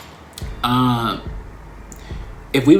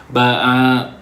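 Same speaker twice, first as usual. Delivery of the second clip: ordinary talk, nothing marked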